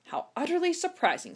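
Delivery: grandfather's dry mock amazement at Sunday; dry chuckle. A narrator's reading. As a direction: tease her with dry irony. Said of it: "with a dry chuckle".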